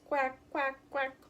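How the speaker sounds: mock big duck quacking. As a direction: honk it like a big cautious duck.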